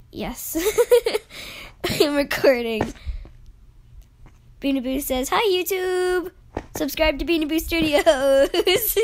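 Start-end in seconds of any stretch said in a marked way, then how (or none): 5.3-9.0 higher, drawn-out cheery greeting; excited shout-out. Retold or imitated. Relaying a friend's hello to YouTube, excited. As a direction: call it out brightly for a friend.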